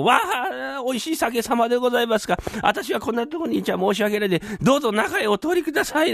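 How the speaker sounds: high mock-polite little voice; a timid spirit in the belly bowing out; voice of the guzzled moonshine. Brother boasts to his partner, in a high mock-polite voice. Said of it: "なんて道を開けたよ".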